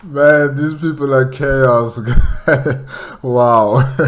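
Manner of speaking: loud, laughing amazement at the orcs' madness; laughing disbelief. Real, not acted. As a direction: burst out laughing, hardly believing it. Listener reacts to chaotic orcs.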